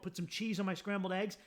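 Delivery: ordinary talk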